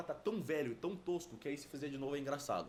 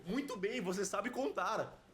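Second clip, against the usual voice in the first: mocking; 6 semitones higher, slower than usual. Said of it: "o enigma me respondeu".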